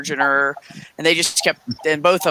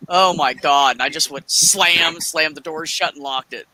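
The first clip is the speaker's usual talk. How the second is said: ordinary talk, nothing marked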